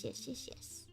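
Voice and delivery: ordinary talk, nothing marked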